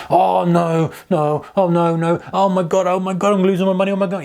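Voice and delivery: oh my God, I'm losing my money, said in mock panic; mock panic over losses. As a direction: play a trader going from cheering to panicking. Acting trader watching P and L.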